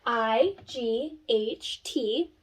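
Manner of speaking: I G H T, slow letter by letter; spelled out. Shown as a demonstration. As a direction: spell each letter slowly. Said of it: "So for an example".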